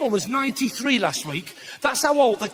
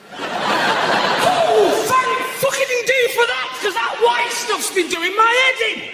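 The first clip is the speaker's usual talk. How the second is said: relieved mock customer; 10 semitones higher, slower than usual. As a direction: cry out in exaggerated gratitude. Customer reacts to advert.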